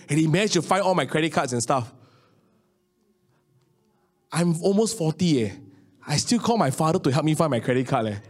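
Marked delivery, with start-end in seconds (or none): none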